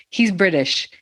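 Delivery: British accent. Shown as a demonstration. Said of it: "I'm tempted to use my British accent".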